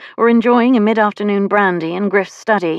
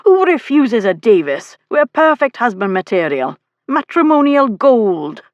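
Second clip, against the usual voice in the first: higher, indignant boasting; annoyed; 5 semitones higher, 4 dB louder than usual. A narrator's reading. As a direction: protest the insult, drawing out the last words. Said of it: "apparently now annoyed on Morgan's behalf".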